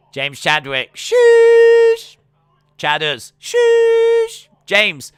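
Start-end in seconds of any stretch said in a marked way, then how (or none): 1.0-2.2 high, loud, drawn-out shush at the crowd. Acted. Streamer shushing a rowdy crowd, loud high shush. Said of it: "I just shooshed everyone". 3.4-4.5 high, loud, drawn-out shush at the crowd. Acted. Streamer shushing a rowdy crowd, loud high shush. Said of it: "I just shooshed everyone".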